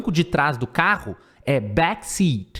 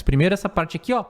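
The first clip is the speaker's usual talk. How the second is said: usual speaking voice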